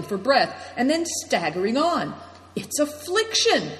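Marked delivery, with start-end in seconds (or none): 2.6-3.7 high cry of recognition; cried out. A narrator's reading. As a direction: exclaim it high, falling at the end.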